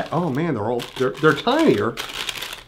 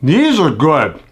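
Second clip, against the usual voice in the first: speaking between bites; chewing while talking; 5 dB louder, slower than usual. Real, not acted. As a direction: mouth partly full. Seen 0: Bites curd at -1, jaw and cheeks chew through early words.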